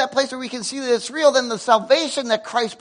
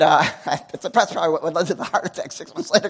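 normal talking voice

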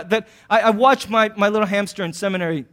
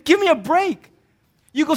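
ordinary delivery

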